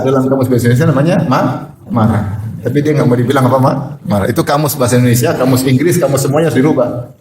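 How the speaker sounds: ordinary talk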